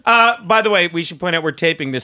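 ordinary talk

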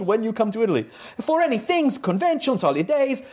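high-pitched Italian fan signing off; exaggerated, high Italian-accented letter voice; put-on Italian accent. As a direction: read broken English in a hammed-up Italian accent. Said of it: "I'm gonna do another accent".

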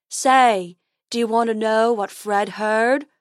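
slow goofy unicorn voice; silly dopey unicorn. Acted.

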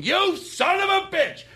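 high, slow mock yell of a furious waitress; furious shout. Tough waitress yells at her coworker, high and slow. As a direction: scream it like a waitress about to throw a punch. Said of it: "the tough waitress".

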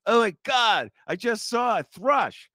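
high-pitched, hammed-up gushing over a sighting; exaggerated awestruck exclamation; mock excited birder. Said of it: "then people go".

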